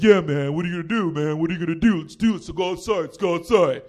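lightly voiced puffed-up chest-beaters; tough-guy bros. Comic mimics friends puffing out their chests, light tough-guy voice. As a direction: imitate a pack of guys acting tough. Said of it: "looking at me tough".

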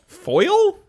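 can't believe the word; incredulous. Real, not acted. Incredulous high question.

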